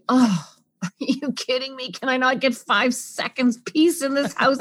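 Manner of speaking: mock exasperated snap with a laugh in it; a frazzled parent losing patience. Frazzled parent at a screaming child, mock exasperated. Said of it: "Our first response is usually".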